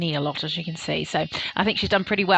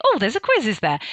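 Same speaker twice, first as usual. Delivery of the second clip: mock surprise; 3 semitones higher than usual. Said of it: "And people saying".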